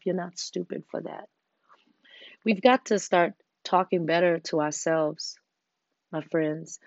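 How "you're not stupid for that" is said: soft whisper. Real, not acted. Reassuring the listener about mistakes.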